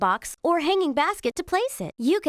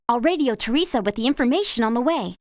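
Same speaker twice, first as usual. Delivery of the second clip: usual speaking voice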